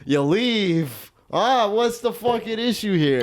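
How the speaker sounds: Italian guy. Acted. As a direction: stretch it like a pushy Italian lothario. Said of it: "This Italian guy sounds like he has riz".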